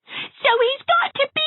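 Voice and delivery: put-on high, loud, shrieking child; shrieking little girl; scream. A narrator's reading. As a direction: scream it in triumph at him. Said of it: "screamed Geraldine".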